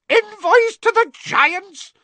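very high, loud slow shriek. A narrator's reading. Fudge shrieking at Dumbledore's plan, very high and loud. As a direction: shriek in disbelief. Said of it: "Fudge shrieked".

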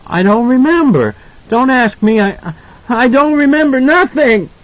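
put-on very high, escalating child's wail; distraught. A narrator's reading. Boy refusing his parents' questions, distraught. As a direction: build to a panicked, stammering boy's protest.

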